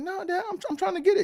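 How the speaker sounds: very high squeaky pleading; whiny kid pleading. Retold or imitated. Son whining to his mad dad, high squeak.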